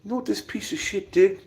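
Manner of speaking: a hint of a hushed outraged storyteller; imitating John Bravo Films. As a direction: lightly mimic an indignant YouTuber. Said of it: "He's like".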